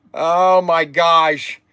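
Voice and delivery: usual speaking voice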